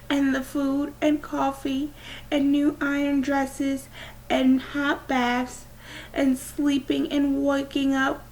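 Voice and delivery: a read Our Town farewell, lightly tearful. A narrator's reading. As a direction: voice lifted and aching with tears. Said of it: "Via was actually crying while she was saying this".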